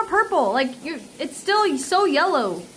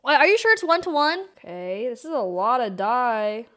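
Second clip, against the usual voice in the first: ordinary delivery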